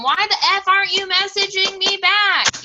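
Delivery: loud, high-pitched frustrated outburst; mock exasperation. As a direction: act out being fed up, voice jumping up. Frustrated at being ignored.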